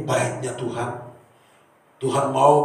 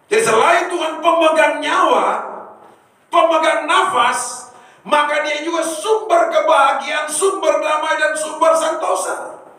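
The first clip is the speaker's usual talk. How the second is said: fervent sermon delivery, pitched high; preaching at full force; 13 semitones higher, 4 dB louder than usual. Acted. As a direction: proclaim it to the whole church. Preacher building to climax.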